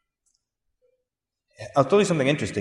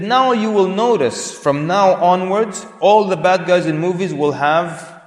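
slow, raised, emphatic pronouncement; professor's prediction; 5 semitones higher, 4 dB louder, slower than usual. Retold or imitated. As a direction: announce it slowly and higher, like a prophecy. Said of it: "Then the professor said".

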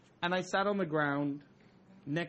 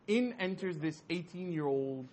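ordinary delivery

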